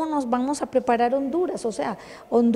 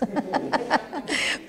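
soft laugh; 3 semitones higher, 4 dB quieter than usual. Real, not acted. Brief laugh mid-talk.

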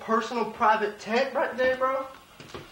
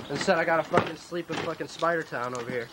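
normal talking voice

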